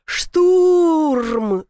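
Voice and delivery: крик в панике, выше и громче; заори «Штурм!» в ужасе; паническое «Штурм!». A narrator's reading. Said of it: "заорал Ар". (панический вопль)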